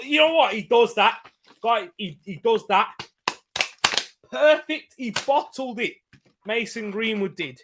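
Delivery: high-pitched indignant rant; exasperated complaint. Real, not acted. Still complaining about the missed chance.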